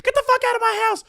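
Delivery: very high, fast mock scream; loud, piercing imitation of an angry host; retold furious screech. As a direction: imitate her screeching him out of the house. Retold host hitting him, throwing him out.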